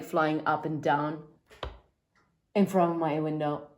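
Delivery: ordinary talk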